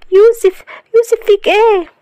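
high, loud panicked calling; alarmed cry. Acted. Wife finding Youssef collapsed on the floor, crying out in panic. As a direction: cry out his name in alarm. Shahd finds husband collapsed.

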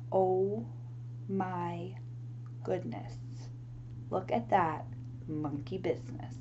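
soft, low near-whisper; hushed wonder. A narrator's reading. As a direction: let the discovery sink in quietly. Hushed reveal of the monkeys.

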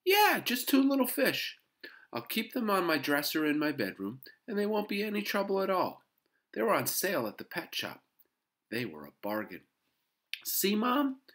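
put-on high, loud kid pitching his case; high-pitched boyish enthusiasm; eager boy persuading. A narrator's reading. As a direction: win Mom over, full of eagerness.

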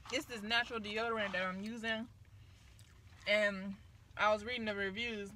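eating fries while speaking; talking while chewing. Real, not acted. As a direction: mouth partly full of food. Seen 2: cheek bulges and jaw working between lines; fry brought to mouth.

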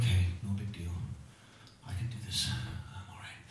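fading into a whisper; nervous self-talk trailing to a whisper; self-reassuring whisper. Retold or imitated. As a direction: whisper to yourself, trying to stay calm. Reassuring himself near Fenway.

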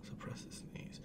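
barely audible voiceless whisper. Real, not acted. Holding back a sneeze, whispered. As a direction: whisper it to yourself.

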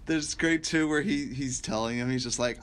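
ordinary delivery

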